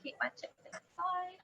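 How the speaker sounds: soft secret whisper aside. Real, not acted. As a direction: whisper it to yourself. Aside about her chips.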